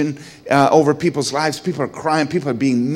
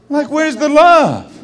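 mock exasperation; 13 semitones higher, 5 dB louder than usual. Wife goes to bed, he's hurt.

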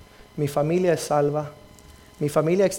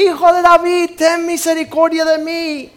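louder cry; 13 semitones higher, 9 dB louder than usual. Retold or imitated. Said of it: "Pero él gritaba más fuerte".

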